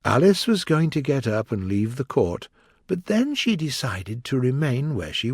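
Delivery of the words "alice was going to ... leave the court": ordinary delivery, nothing marked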